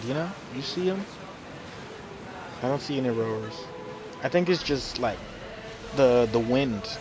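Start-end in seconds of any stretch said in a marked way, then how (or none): none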